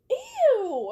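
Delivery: sudden high loud "ew"; disgusted. Real, not acted. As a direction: yelp in disgust. Reacts to own burp.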